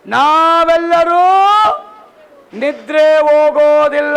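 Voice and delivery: loud, high proclamation, slowing on the last words; thundering the apostle's words to the congregation; preaching scripture. Real, not acted. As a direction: call out the verse like a herald.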